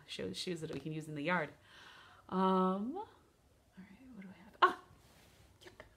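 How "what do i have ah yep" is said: ordinary talk, nothing marked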